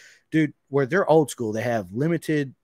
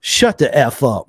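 louder and slow, retold disbelief; stunned disbelief; 10 dB louder, slower than usual. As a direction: blurt it out, eyes wide. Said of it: "and I said".

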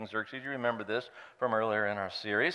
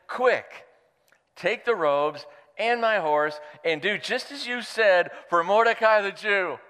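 higher, eager royal order; commanding king; 7 semitones higher, 5 dB louder than usual. Acted. Pastor playing the king ordering Haman, brisk and eager. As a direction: hurry him along gleefully. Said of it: "the king said to Haman".

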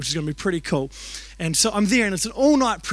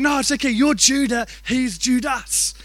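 higher voice, "Judah" and "Judas" set apart; explaining the difference; 6 semitones higher, 4 dB louder than usual. Retold or imitated. Dad to upset son, patiently contrasting "Judah" and "Judas". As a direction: spell out "Judah" against a slowed "He's Judas".

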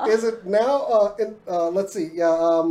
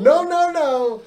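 excited; 8 semitones higher, 5 dB louder, much slower than usual. Real, not acted. Big reveal of show news.

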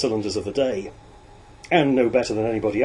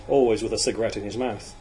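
ordinary delivery, nothing marked

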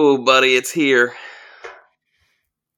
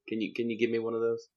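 normal talking voice

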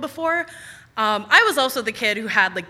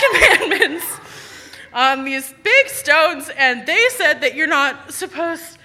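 rambling, laughing, rising higher; feverish mom; 3 semitones higher, 4 dB louder, slower than usual. Retold or imitated. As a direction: stumble through it, dazed. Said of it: "in her, like, fever delirium".

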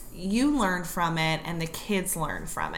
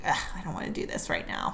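low, quick, softer mock grumble; grumbling; 11 semitones lower, 5 dB quieter, much faster than usual. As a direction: grumble it like a reluctant worker. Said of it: "just sitting there grumbling".

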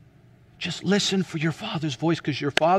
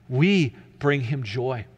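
ordinary talk

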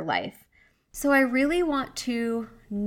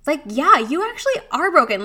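high and loud, an excited aha; sudden realization; 7 semitones higher, 4 dB louder than usual. Retold or imitated. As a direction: hit the discovery with a jump in pitch. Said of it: "I started realizing, like".

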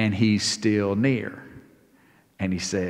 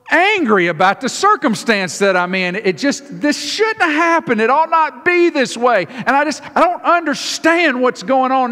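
higher and louder, a hammed-up frustrated rant; mock angry congregant; 10 semitones higher, 4 dB louder than usual. Congregant telling the pastor they're mad at their situation, high and heated. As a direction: vent like someone fed up with their circumstances. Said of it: "I think it looks like".

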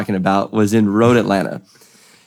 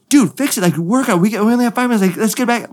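high-pitched mock yell, no real volume; retold urgent yelling; 8 semitones higher than usual. Driver pressing his crew to fix the car, retold yelling. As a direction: imitate an urgent teammate hurrying you along. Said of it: "he's yelling at me".

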